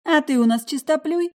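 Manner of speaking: high, loud sneering retort; mocking. A narrator's reading. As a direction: needle him mockingly. Karina mocks Felix.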